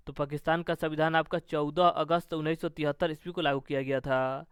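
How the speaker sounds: usual speaking voice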